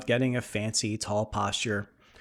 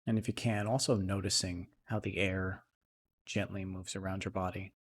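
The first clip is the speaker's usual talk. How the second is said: soft, unhurried meditation voice with long pauses; guided meditation; 2 semitones lower, 5 dB quieter than usual. Acted. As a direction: settle into a quiet, even, calming guide's voice.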